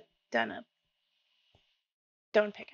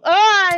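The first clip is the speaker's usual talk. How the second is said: much louder, higher sudden ah; panicked yelp; 9 semitones higher, 18 dB louder than usual. Real, not acted. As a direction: yelp as you're shoved. Pushed toward a hole.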